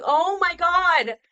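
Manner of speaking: loud, drawn-out disbelief; real shock. Real, not acted. Shocked at Fowler call-up news.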